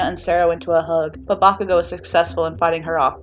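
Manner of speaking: usual speaking voice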